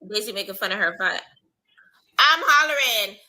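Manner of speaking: ordinary talk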